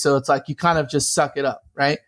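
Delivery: usual speaking voice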